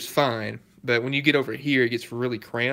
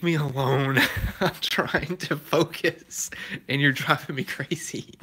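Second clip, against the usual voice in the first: laughing; 3 semitones higher, slower than usual. Real, not acted. Teasing chat while working.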